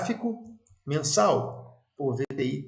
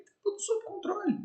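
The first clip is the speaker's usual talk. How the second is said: very high, soft falsetto; playful reassurance; 5 dB quieter than usual. Acted. Telling followers all is fine amid market chaos, high playful reassurance. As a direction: squeak it softly, far above your usual voice.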